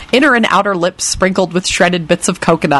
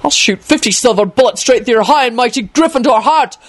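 higher-pitched furious threat; angry shouted threat; 5 semitones higher than usual. Acted. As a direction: rant the threat in one rush of rage. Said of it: "Quentin shouted".

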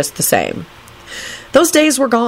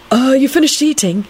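higher, tentative polite question; 8 semitones higher than usual. A narrator's reading. Young man asks his date about her meal, politely. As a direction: ask politely and a little hesitantly. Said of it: "he asked politely".

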